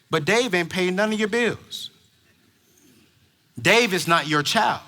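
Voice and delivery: normal talking voice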